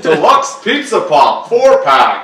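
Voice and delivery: loud, slow commercial announcer; hammed-up product announcement; mock ad read. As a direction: pitch it like a cheesy TV spot.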